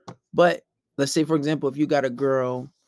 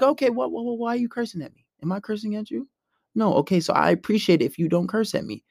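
composed, polite objection; reasonable; 5 semitones higher than usual. Shown as a demonstration. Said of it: "be reasonable and be logic".

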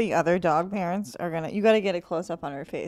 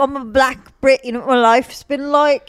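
loud, higher indignant Londoner; aggrieved London voice; London accent; 5 semitones higher, 11 dB louder than usual. Retold or imitated. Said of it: "They're like".

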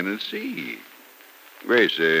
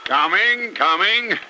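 calling out; 10 semitones higher, 4 dB louder, slower than usual. Acted. Jokey arrival calling out.

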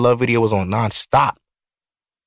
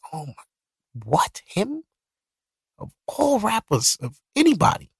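disbelief; 10 semitones higher, 5 dB quieter than usual. Retold or imitated. Disbelief at rapper's death.

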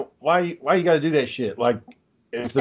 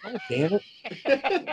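slow, soft groan through a laugh; laughing resignation; 6 semitones higher, 8 dB quieter, much slower than usual. Retold or imitated. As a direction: sigh it out while laughing, drawn out. Retold frustration at home.

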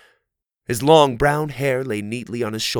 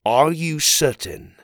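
stern questioning; 5 semitones higher, 3 dB louder, slower than usual. A narrator's reading. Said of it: "gave Oliver a stern look".

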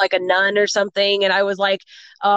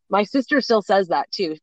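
ordinary talk

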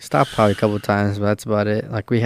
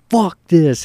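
higher mock-angry outburst; retold yelling; 8 semitones higher than usual. Traveler walking away from the flight desk, retold yelling. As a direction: imitate him cursing as he storms off. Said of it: "He's fucking yelling, screaming".

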